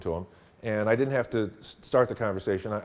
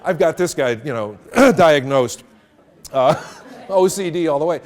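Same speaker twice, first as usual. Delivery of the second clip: laughing aside; 3 semitones higher, 10 dB louder than usual. Retold or imitated. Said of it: "I'm like".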